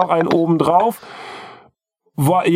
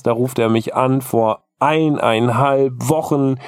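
ordinary delivery

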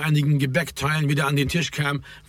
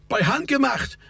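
high-pitched call, no real shout; lightly projected interjection; 10 semitones higher than usual. A narrator's reading. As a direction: call it across, pitched well up. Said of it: "rief Raimund".

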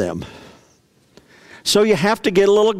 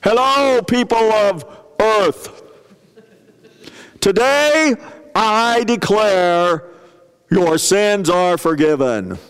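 a hint of a grand cosmic ruler; booming arrogant alien overlord; 3 semitones higher, slower than usual. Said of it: "issue a pardon from heaven".

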